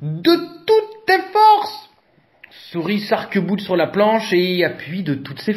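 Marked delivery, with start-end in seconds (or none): 0.0-1.9 high, loud and slow urging; slow high call of encouragement. A narrator's reading. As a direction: stretch it out, willing her to push. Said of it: "l'encourage Éléphant".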